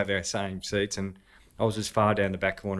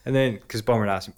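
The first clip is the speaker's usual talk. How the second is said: ordinary talk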